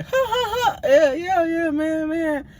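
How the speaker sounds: very high mock giggling voice; imitated laughing reply. Roommate laughing off his sleep talking, high mimicked laughter. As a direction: squeal the answer through put-on laughter. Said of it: "He just start laughing".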